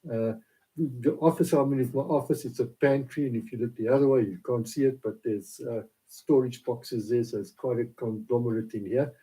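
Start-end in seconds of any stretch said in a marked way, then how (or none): none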